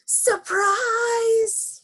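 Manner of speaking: very high, drawn-out party shout; shouted surprise. A narrator's reading. All the ladies to Miss Alma, high gleeful shout. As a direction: shout it high and stretch the end. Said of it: "all the ladies shouted".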